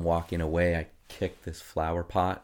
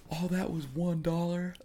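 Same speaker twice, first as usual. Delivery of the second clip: put-on high childlike boy voice, pitched well up; voicing the son; 12 semitones higher than usual. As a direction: play the kid proudly announcing a chore.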